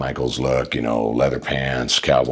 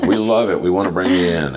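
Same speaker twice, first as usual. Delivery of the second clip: imitated boss, higher-pitched and keen; lightly voiced enthusiastic boss; Vince McMahon; 5 semitones higher, 3 dB louder than usual. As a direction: retell the boss's eager approval, pitched up. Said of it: "and he's like".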